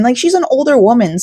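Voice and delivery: ordinary talk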